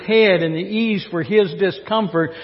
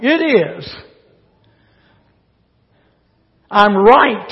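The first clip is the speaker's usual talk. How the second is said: furious prophet defending his anger; angry retort; 2 semitones higher, 5 dB louder than usual. Acted. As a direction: bark back at God, sure you're right. Jonah angrily answering God.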